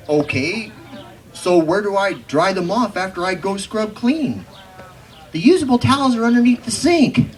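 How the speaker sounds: loud, high, lively recital of a poem's quarrel; hammed-up cowboy poetry performance. Recites poem with dialogue voices.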